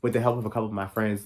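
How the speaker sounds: rapid, rushed delivery; fast. Shown as a demonstration. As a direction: rattle it off quickly. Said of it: "I speak so damn fast".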